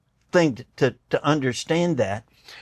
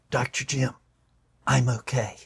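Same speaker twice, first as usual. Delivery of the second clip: softer, barely getting the words out; choked-up quote; 2 semitones lower, 6 dB quieter than usual. Retold or imitated. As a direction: retell it as if your throat closes up. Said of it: "he could barely talk".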